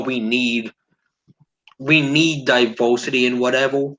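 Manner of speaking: ordinary talk, nothing marked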